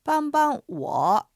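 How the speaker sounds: 帮帮我 said very slowly, each syllable clear, 帮 level then light, 我 dipping; slow Chinese demo. Shown as a demonstration. Teacher modelling 帮帮我 for Japanese listeners, slow and clear. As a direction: spell out 帮帮我 slowly for learners. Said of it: "二種類ご紹介します".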